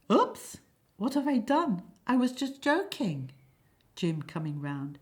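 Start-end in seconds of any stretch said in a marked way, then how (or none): 0.1-3.3 innocent. Acted. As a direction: play the innocent, voice up high. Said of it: "Peter innocently".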